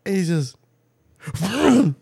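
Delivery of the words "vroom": mimicked engine vroom. Engine noise imitated in story.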